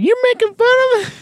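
very high, fast parroting echo; copying her. Retold or imitated. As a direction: mimic her back quickly. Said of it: "He's like".

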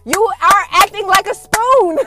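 loud, high, slow mock telling-off; mock scolding. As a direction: scold him like a silly child. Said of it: "I'm like".

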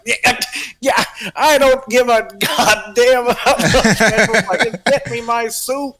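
lampoon a cranky grandfather brushing off the waiter; gruff grandpa. Retold or imitated. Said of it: "he'll look at the waiter like".

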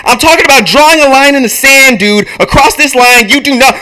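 high, loud, furious tirade; acted blowhard outburst; ranting shout. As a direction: shout him down and hammer the point. Walter rants back in script.

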